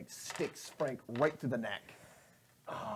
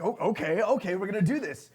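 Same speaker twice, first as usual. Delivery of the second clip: resigned bracing; 7 semitones higher, 7 dB louder than usual. Acted. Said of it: "she's like".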